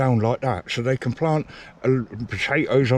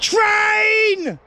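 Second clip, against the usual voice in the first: much higher, louder shout of train; full shout; 5 dB louder than usual. Real, not acted. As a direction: yell it so the whole valley hears. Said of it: "that weren't loud enough".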